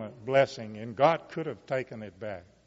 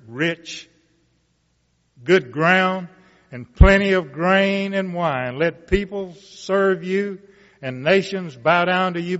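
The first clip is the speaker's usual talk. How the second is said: measured, rising Bible reading voice; scripture reading; 4 dB louder than usual. Acted. Preacher reading Genesis aloud to the congregation, solemn and building. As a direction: read the blessing to the congregation, building.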